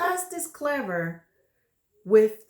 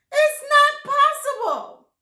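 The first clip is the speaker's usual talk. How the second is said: much higher, louder panicked denial; shocked wife; 10 dB louder than usual. Acted. Wife refusing to believe it, high loud shriek. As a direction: shriek it in disbelief.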